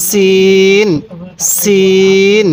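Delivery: সিন with a thin hissed s, vowel held long; thin seen. Shown as a demonstration. Teacher models the letter for learners, thin seen. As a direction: draw out সিন on a thin, sharp s. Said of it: "আওয়াজটা অনেক চিকন করব".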